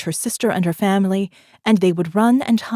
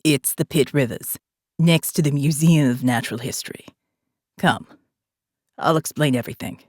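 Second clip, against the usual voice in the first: put-on calm commander, a light South African accent; faint South African accent; 4 semitones lower than usual. A narrator's reading. Jake speaks to Morgan.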